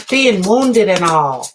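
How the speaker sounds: put-on corny sitcom kid voice; old-timey kid voice. Shown as a demonstration. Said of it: "I don't even talk like that".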